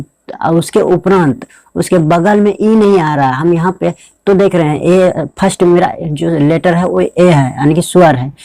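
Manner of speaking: ordinary delivery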